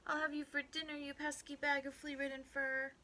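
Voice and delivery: high, loud threat; little man's yell. A narrator's reading. Little man threatening the rabbit down the hole, yelling. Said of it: "the little man yelled down the hole".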